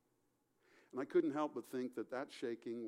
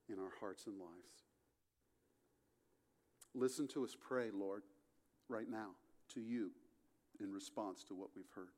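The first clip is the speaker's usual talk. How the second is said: closing prayer. Acted. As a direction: pray over the congregation, gently. Pastor leads closing prayer.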